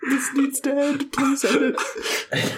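whiny plea. Acted. Groaning plea during gross joke.

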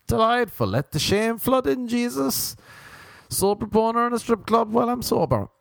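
high, strained fake delight; high-pitched flustered babble; mock embarrassment. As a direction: push it up high, desperately pretending all is lovely. Acts embarrassed man avoiding looking.

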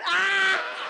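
high, very loud acted scream; full-throated yell of mock terror. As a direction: scream as if suddenly dropped. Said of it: "I am literally screaming".

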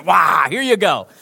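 loud mock yell; caps-lock blast. God imagined with caps lock on, mock yell. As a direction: burst out as if in capital letters. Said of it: "it would be with the caps lock on".

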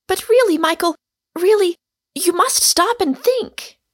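high, loud urgent plea; earnest cry. A narrator's reading. Girl begging Michael to stop and think, earnest cry. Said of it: "cried the girl earnestly".

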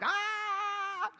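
high, sustained cry of overwhelm; mock scream. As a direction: scream as if life is overwhelming you. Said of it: "life has me, like, going like".

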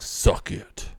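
breathy whispered suck it; whispering the insult in English; whispered taunt. Retold or imitated. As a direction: hiss it under your breath. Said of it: "You'll be all like".

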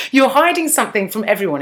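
laughing through the words; giggling as she tells it. Real, not acted. Friend retelling a TV scene, laughing.

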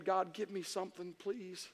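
soft retold plea, trailing away; hushed little prayer for help; pleading prayer. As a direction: pray it small and quiet. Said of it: "I'm praying".

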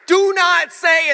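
very slow, high and loud; drawn-out mock plea; slow pleading. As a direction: beg him not to say it. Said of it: "I'm thinking".

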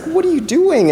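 high-pitched startled challenge; incredulous owner. Acted. Colt owner catches disciples taking his donkey, incredulous. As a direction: shoot the question up high, in disbelief.

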